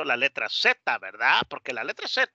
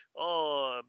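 ordinary talk, nothing marked

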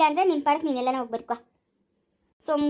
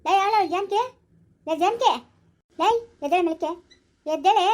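raised, insistent wake-up call; calling to wake someone; 3 semitones higher than usual. Acted. Rousing a woman asleep in the bed, insistent raised call. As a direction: rouse her with sharp bursts.

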